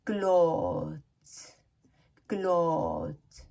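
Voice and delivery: क्लॉथ्स slow and stretched, th hidden; th barely audible. Shown as a demonstration. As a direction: draw out the vowel of क्लॉथ्स. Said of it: "पता नहीं चलता है कि वो टीएच".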